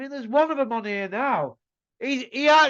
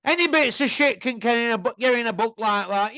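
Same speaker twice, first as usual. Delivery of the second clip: ordinary delivery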